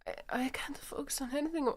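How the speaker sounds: high, halting and breathy, unable to settle; panicking. Acted. Yuri confessing she can't calm down, panicked whisper.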